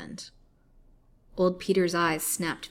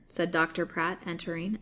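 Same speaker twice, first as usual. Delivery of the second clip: ordinary delivery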